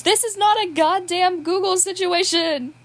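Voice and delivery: Will calls down to Hannibal from upstairs, loud and high; calling from upstairs. Acted. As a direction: shout it up the stairs, half amused. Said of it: "came a voice from upstairs".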